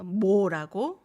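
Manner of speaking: "뭐라고" with extra air on 뭐, nearly like 보; emphatic airy onset. Shown as a demonstration. Teacher shows why 뭐 sounds like 보, "뭐라고" with an airy, emphatic first sound. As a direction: punch "뭐라고" so the ㅁ bursts like a b. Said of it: "there might be a little more air coming out".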